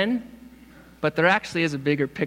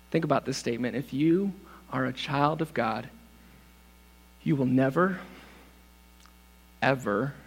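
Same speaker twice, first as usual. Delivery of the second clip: soft, gentle assurance; tender and soft; 4 semitones lower, 7 dB quieter than usual. Real, not acted. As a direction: reassure them quietly. Tender quiet stretch.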